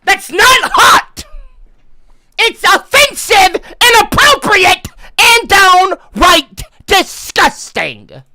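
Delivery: indignant shout. Real, not acted. Streamer scolds chat, indignant shout. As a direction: lay down the law, slowing at the end.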